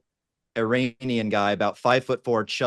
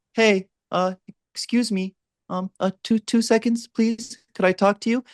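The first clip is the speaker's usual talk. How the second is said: much higher, sheepish polite voice; high-pitched approach; 11 semitones higher than usual. Retold or imitated. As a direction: squeak it out like a shy giant. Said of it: "he would open girls like".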